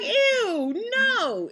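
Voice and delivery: high, loud ew of disgust. Retold or imitated. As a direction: recoil in disgust. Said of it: "In my head".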